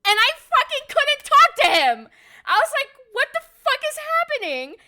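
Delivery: very high, loud freaking-out voice; starstruck squeal. Real, not acted. Telling viewers about freezing up before Usopp, a very high squeal. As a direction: squeal it like a fangirl losing it. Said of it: "opened my mouth and nothing came out".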